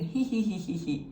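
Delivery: mock giggle. Shown as a demonstration. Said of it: "as if you're laughing at something".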